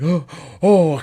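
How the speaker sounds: high, loud gasping oh oh; shocked by icy water, gasping; gasping from the cold. Retold or imitated. Retelling the cold plunge, high gasping cry. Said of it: "it was so cold that, like, my, I couldn't breathe".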